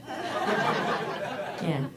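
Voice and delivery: ordinary delivery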